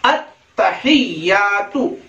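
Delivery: "अत्तहियातु" drawn out with a clear h; long vowels and sounded ह in "अत्तहियातु"; slow correct recitation. Shown as a demonstration. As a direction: slowly say "अत्तहियातु" as "at-ta-hiy-yaa-tu". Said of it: "फिर और टुकड़े टुकड़े करके".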